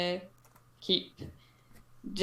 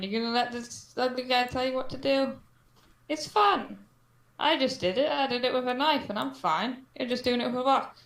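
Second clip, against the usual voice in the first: playful coaxing; 5 semitones higher than usual. Acted. Player coaxing NPC girl to juggle.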